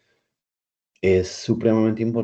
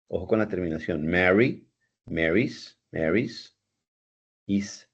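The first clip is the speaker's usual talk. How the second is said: ordinary delivery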